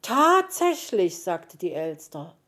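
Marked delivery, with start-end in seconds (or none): 0.0-1.2 high, loud, drawn-out surprise; surprised discovery. A narrator's reading. Magpie spotting the boots by the door, surprised. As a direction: stretch it out in astonished delight.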